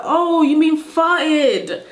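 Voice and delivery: high, slow, drawn-out "oh"; mock classmate finally getting it; imitated dawning realisation. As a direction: let the penny drop slowly.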